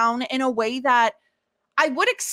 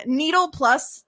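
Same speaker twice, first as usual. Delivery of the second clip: ordinary delivery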